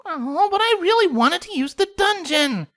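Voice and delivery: high, whiny pony complaint; complaining. A narrator's reading. Pinkamena whines to the friends, high and petulant. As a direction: pout it out. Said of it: "Pinkamena complained".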